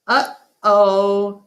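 loud, high parrot squawk; loud, high macaw mimicking a phrase. A narrator's reading. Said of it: "says Thelma".

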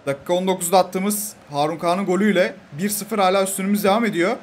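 normal talking voice

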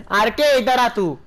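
slow, loud call stressing R K; drawn-out call. Real, not acted. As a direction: stretch out the name R K loudly. Calling teammate RK again.

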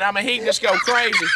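normal talking voice

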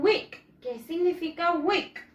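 usual speaking voice

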